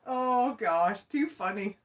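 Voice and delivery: normal talking voice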